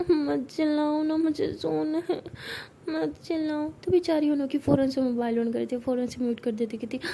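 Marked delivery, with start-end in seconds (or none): 0.1-3.7 slow, higher whiny plea; half-asleep girl begging the shouting mother to stop, whiny; sleepy whine. Acted. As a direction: complain drowsily, stretching it out. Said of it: "वो कहती है".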